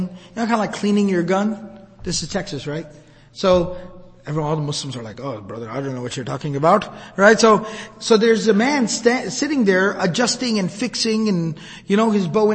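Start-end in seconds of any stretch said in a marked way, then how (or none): 5.2-6.9 low, quick murmured reply; lightly voiced baffled congregant, pitched down; mock clueless listener. Mock quote of the audience.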